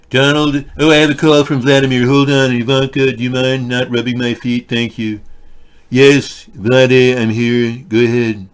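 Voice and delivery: hammed-up Trump taking a call from Vladimir; Trump impression. Trump voice skit on phone.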